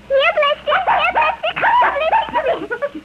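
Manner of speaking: high calling voice across the house; calling the dog. Acted. Girl calling her puppy from the room, high call. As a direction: call the puppy over, rising.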